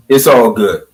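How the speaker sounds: ordinary talk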